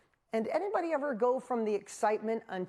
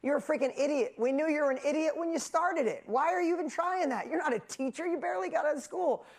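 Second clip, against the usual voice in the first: mock him as an idiot; mocking friends; 7 semitones higher than usual. Friends mocking Dean's infomercial idea, scoffing. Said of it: "they were like".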